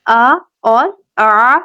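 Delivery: آ light then آ squeezed, slow; آ glottal, then آ throaty; hamza vs ain. Shown as a demonstration. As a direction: contrast the two آ sounds. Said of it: "تھوڑا سا فرق ہوگا".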